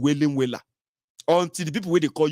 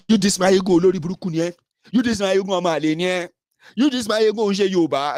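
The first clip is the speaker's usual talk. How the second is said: mock insulting critic; 6 semitones higher, 5 dB louder than usual. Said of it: "everybody will come there like dogs".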